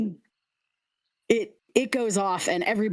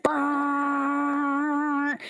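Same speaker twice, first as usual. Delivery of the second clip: high droning brrr of a foghorn; a long buzzing foghorn noise; foghorn blast; 7 semitones higher than usual. Acted. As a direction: blare it out like a ship's horn. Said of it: "Huge foghorn".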